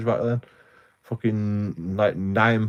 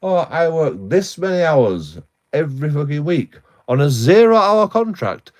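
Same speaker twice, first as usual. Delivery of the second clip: mock claim to officials; 5 semitones higher, 6 dB louder than usual. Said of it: "you've gotta fucking go".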